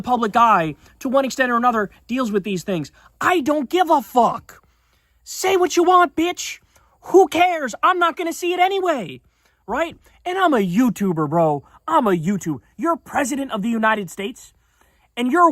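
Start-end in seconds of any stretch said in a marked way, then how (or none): 3.2-12.6 defiant outburst. Real, not acted. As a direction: fling it at the haters, I don't care. Defiant rant at online critics.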